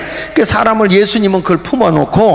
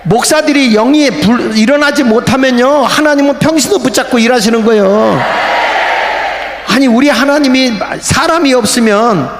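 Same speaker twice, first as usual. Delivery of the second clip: raised, rousing sermon voice with shouted peaks; fiery preaching; 5 semitones higher than usual. Acted. Pastor urging the congregation to rise up, at full force.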